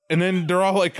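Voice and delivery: normal talking voice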